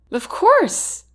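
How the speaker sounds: high, eager exclamation; excited exclaim. Acted. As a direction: blurt it out with delight. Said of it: "I exclaim".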